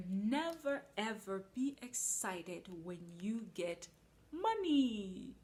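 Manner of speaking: slow, rising to loud on money; preaching a rule. Acted. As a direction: lean on the rule slowly.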